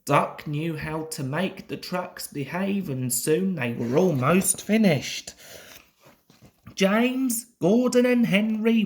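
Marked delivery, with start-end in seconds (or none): none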